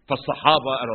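ordinary talk, nothing marked

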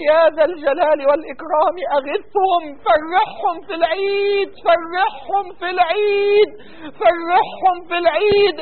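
fervent, drawn-out Arabic du'a; impassioned supplication. Real, not acted. Imam leading prayer for suffering brothers, fervent pleading. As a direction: plead with God, stretching the ends of phrases.

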